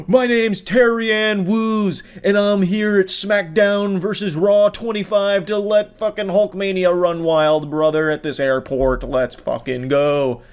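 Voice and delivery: slow, drawn-out ring-announcer hype; wrestling entrance announcement. Retold or imitated. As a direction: announce yourself like a wrestler entering the arena. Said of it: "like it's a W W E entrance".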